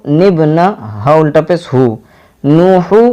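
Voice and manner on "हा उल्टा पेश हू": normal talking voice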